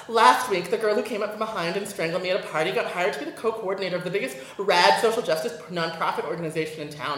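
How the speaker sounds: usual speaking voice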